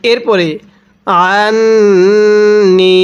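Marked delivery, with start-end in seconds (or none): 1.3-3.0 আন্নি with the ন্ন held nasal and long; ghunnah. Shown as a demonstration. Teacher demonstrates ghunnah, slow nasal hold. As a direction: hum through the nose on আন্নি. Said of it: "এখানে গুন্নাহ করব".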